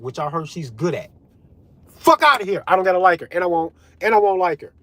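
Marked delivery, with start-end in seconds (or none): 1.9-2.6 high, loud dismissal; scornful shout; dismissive outburst. Real, not acted. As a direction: throw them out with a raised voice. Dismissing the politician he dislikes.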